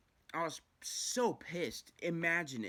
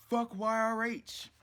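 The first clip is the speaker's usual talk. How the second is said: louder, slow, drawn-out cursing; slow emphatic curse; 8 semitones higher, 7 dB louder, slower than usual. Real, not acted. Damning the treatment place, slow and loud. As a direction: spit each letter out slowly.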